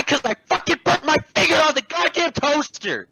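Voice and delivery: high-pitched exasperated outburst; worked-up, high complaint; indignant rant. Retold or imitated. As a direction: voice shooting up in outraged self-defense. Acted indignant comeback to customer.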